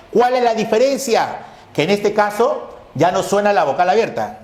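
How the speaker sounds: ordinary delivery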